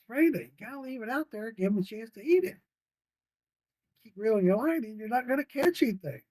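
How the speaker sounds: grandpa correcting. Retold or imitated. Grandpa to granddaughter reeling too much, coaxing advice. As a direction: patiently tell her to leave the line.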